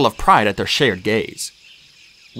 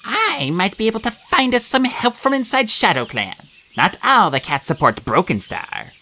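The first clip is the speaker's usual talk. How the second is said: put-on high, pitched-up old she-cat; 10 semitones higher than usual. A narrator's reading. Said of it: "Yellowfang answered him first".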